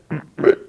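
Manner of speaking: a quick amused laugh; short laugh. Real, not acted. Recapping the scene, a short laugh. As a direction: laugh lightly before the quote.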